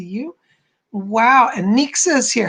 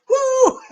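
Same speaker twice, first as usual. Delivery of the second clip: excited whoop. Real, not acted. End of jam.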